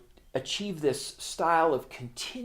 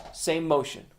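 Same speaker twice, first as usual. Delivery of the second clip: usual speaking voice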